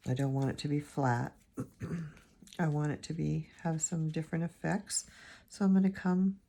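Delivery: ordinary talk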